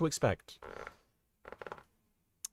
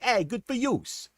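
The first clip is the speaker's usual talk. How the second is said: Joey voice; 6 semitones higher, slower than usual. Retold or imitated. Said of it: "Joey would be like".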